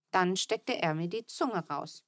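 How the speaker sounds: plain reading voice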